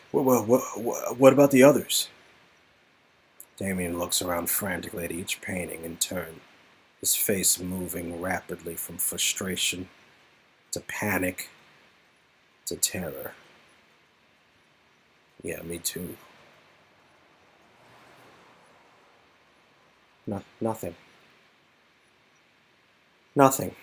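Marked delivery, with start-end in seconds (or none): 3.6-13.3 much softer, lower narration; hushed narration. A narrator's reading. 15.4-23.7 much softer, defeated voice; a man realizing he feels nothing; despairing Damien. Acted.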